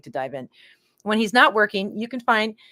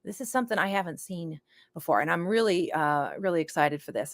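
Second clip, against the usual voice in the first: ordinary delivery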